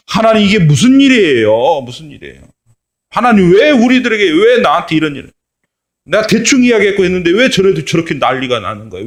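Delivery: high, loud whining protest; complaining. Acted. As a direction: protest to God like a sulking child. Jonah complains to God.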